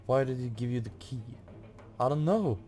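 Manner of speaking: usual speaking voice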